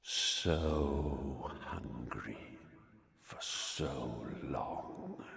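drawn-out, very low whisper; cold murderous voice. A narrator's reading. Disembodied voice Harry strains to hear, cold murderous whisper. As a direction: whisper it slowly, low and menacing. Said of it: "murderous voice he had heard".

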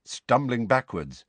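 ordinary delivery, nothing marked

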